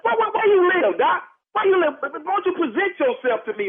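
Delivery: outraged man demanding the caller's identity; incredulous demand. Real, not acted. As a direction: demand to know who he is. Challenging the unknown caller.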